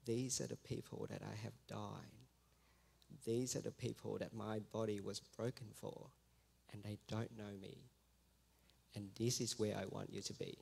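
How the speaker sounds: low, soft, voiced near-whisper; imitated little whispering voice. God speaking inwardly to the listening missionary, softly imitated whisper. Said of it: "I heard this little voice whispering".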